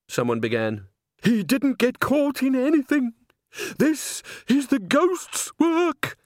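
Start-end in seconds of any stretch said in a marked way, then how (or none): 1.2-6.2 high, slow, eerie warning; spooked bystander. Acted. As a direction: drag it out, haunted and high. Spooked bystander blames the ghost.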